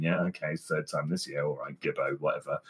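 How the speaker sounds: low, soft, resigned sigh of a voice; co-host sighing at his friend quitting again; weary sigh. Retold or imitated. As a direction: mutter it like a tired friend who's heard it all. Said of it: "sighing and going".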